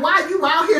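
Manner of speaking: angry mother demanding a stranger's reason; mom cussing him out. Retold or imitated. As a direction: make him explain himself. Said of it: "She cussing him out".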